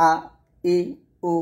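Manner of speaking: আ, ই, উ each separate; paced vowel drill on আ, ই, উ; throat-onset Hamza. Shown as a demonstration. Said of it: "হলকের শুরু থেকে আপনাকে".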